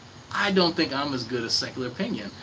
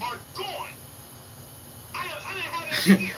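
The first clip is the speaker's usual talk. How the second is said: breathy high giggle at a chat message; real laugh. Real, not acted. Streamer laughing at a chat message, breathy giggle. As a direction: laugh it off under your breath.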